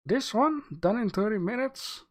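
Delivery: high, questioning disbelief; incredulous. Real, not acted. Reviewer doubting a viewer's time claim, incredulous. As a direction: query it in surprise, pitch jumping up. Reacts to claimed thirty-minute job.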